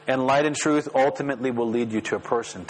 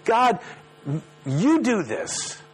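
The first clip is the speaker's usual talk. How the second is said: demanding prayer; 8 semitones higher, much slower than usual. Acted. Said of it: "the psalmist finally turns and says".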